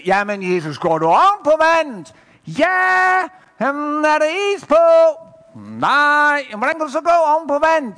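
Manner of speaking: high, loud back-and-forth hollering; calling across water. Acted. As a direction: shout each word to a distant listener. Shouted exchange across the water.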